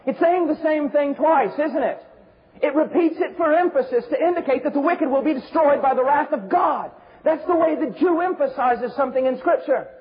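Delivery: high, urgent pulpit delivery; forceful preaching. Real, not acted.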